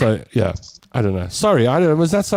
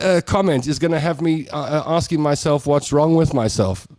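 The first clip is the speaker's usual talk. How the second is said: usual speaking voice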